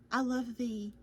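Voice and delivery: hiccuping mid-sentence; talking through the hiccups. Real, not acted. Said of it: "sorry, I've got the hiccups".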